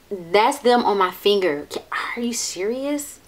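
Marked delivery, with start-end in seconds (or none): 1.7-3.2 stunned breathless whisper; awed whisper. Real, not acted. Vlogger amazed by her swatch, in a stunned whisper. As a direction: whisper it in disbelief.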